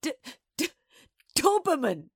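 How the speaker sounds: high, loud stammering splutter; old lady convulsed, stuttering the first sound; sputtering. A narrator's reading. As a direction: splutter the word out through mirth. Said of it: "old Missus B sputtered and shook".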